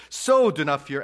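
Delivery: loud, high preacher's proclamation; forceful preaching. Real, not acted. Pastor declaring God's care to the congregation, loud preaching. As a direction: proclaim it to the back row.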